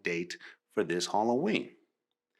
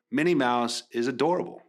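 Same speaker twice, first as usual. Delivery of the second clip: ordinary delivery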